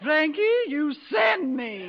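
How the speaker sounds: slow, swooning and loud; swooning gush. Acted. Headmistress gushing at her idol, swooning. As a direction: melt over him like a fan.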